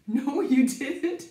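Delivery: ordinary talk, nothing marked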